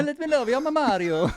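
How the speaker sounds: high, hammy mock-Italian voice; Mario impression. Joining co-host's bit as Mario, high cartoon voice.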